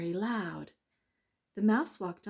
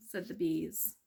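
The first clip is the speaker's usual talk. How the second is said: ordinary delivery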